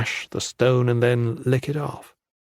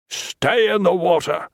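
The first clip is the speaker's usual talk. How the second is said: sharp, raised command from a stern monk; 6 semitones higher than usual. A narrator's reading. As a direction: call it out like a hard teacher. Tan's voice calls out.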